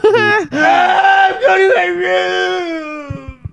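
very high, long drawn-out scream; hammed-up kid yelling as he storms off; mock tantrum. Acts an angry kid storming off.

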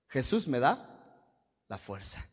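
normal talking voice

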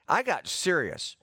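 ordinary talk

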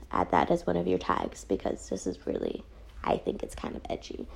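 very soft, near-whispered confidence; hushed aside. Real, not acted. Reviewer advising Crunchyroll on tags, hushed aside. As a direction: drop to a murmur, as if slipping a private tip.

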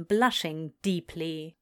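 plain reading voice

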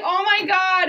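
loud, high retold exclamation; excited re-enacted outburst; overjoyed. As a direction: gush it, sharing the friend's joy. Retold joyful reaction to friend's shot.

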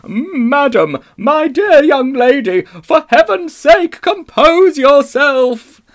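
very high, loud, drawn-out plea; trembling scream. A narrator's reading. Uncle Andrew pleading with the witch, trembling scream. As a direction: scream it shakily, begging her to stop. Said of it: "in a sort of trembling scream".

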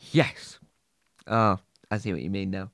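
ordinary talk, nothing marked

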